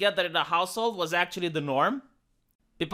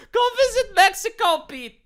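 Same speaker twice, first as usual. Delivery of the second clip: high, laughing call to Peter; laughing jab; 13 semitones higher, 3 dB louder than usual. Real, not acted. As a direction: laugh as you tell him.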